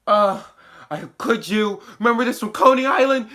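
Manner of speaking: loud, high, fast accusing outburst; angry confrontation. Retold or imitated. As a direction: throw it at a traitor friend, rising fast. Chase confronts Comanche before shooting.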